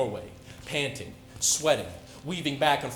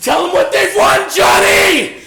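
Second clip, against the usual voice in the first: much higher and louder manic screaming; unhinged roaring tirade; furious shout; 13 semitones higher, 15 dB louder than usual. Retold or imitated. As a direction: rave at her as the hammer falls. Killing rage, shouting at victim.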